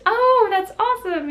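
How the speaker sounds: high, bright mock stranger's voice; imitating the stranger. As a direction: lightly play the woman, pleased and chirpy. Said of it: "she goes".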